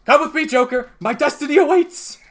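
high, loud, manic character voice; Mad Hatter. Acted. Little man barreling towards the Joker, high manic shout. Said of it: "you see the Mad Hatter".

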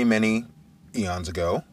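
usual speaking voice

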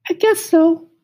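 very high, sing-song cricket asking who's there; high musical cricket. Acted. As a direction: squeak it up high like a tiny cricket. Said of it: "He had a high musical voice".

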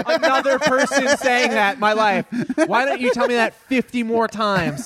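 high-pitched sarcastic rant; mock exasperation. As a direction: whine it with exaggerated sarcasm. Sarcastic rant at not being heard.

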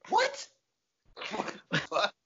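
sudden very high yelp; shocked disbelief. Retold or imitated. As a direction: react in total disbelief.

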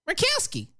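high-pitched, exaggerated name; mocking name-call. Host naming the senator, mocking high voice.

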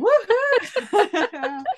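high, loud cheer; excited whoop. Real, not acted. Host cheering about picking up kids on Friday, excited whoop. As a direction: whoop with glee.

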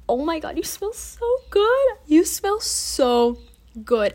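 high-pitched, gushing emotional praise; getting emotional. Real, not acted. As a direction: gush with emotion, voice climbing high. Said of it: "I'm getting emotional".

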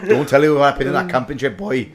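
put-on jokey Uncle Bryn-style Welsh accent. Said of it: "You've got quite a good Welsh accent".

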